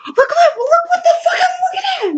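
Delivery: high-pitched hysterical cry; retold panic. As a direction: re-enact a terrified, frantic outburst. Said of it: "I was so speechless".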